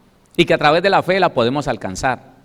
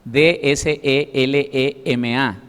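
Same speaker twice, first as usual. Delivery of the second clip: letters spelled very slowly one by one; spelling out; much slower than usual. Shown as a demonstration. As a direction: spell each letter deliberately. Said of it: "con acento en la primera E". (deletreando lentamente)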